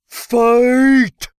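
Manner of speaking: high, loud, drawn-out battle cry; yell. A narrator's reading. Mugza launching himself at the emperor, gleeful yell. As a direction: yell it to start a brawl. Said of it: "the irrepressible Mugza yelled".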